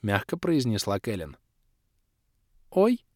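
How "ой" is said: high startled squeak. A narrator's reading. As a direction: squeak in surprise. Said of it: "пискнула Алиэла Седри". (пискнуть высоко: «Ой!»)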